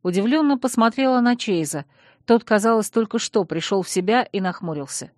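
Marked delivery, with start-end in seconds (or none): none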